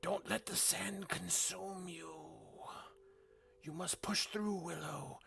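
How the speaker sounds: high, slow disembodied hag voice; old hag. Acted. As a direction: call from afar like a ghostly crone. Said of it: "you hear the hag's disembodied voice".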